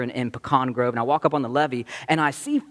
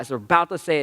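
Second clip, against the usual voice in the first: ordinary talk, nothing marked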